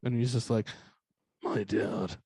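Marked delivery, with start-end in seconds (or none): 1.4-2.2 low, soft, dazed mumble imitation; subdued, drugged-sounding reply; medicated kid. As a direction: mimic a heavily medicated kid answering. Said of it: "mad medicated".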